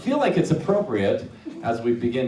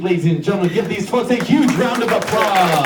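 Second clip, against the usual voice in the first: louder, higher showman call; hype announcer; 6 semitones higher, 7 dB louder than usual. Real, not acted. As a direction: whip the crowd into applause. Hyping the crowd for applause.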